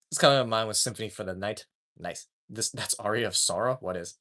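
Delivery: ordinary delivery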